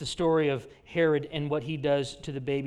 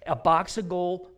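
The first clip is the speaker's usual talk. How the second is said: ordinary talk, nothing marked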